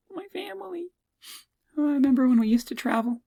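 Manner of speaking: high, tearful put-on wail; mock crying. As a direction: act choked up with longing for family trips. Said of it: "stop crying".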